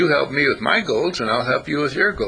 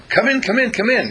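much higher, excited come-in call; eager welcome; 11 semitones higher than usual. Retold or imitated. Neighbours welcoming the visitor with cake, high and excited. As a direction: gush like a delighted host.

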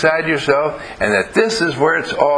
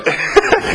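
high laugh. Real, not acted. Laughs at own joke.

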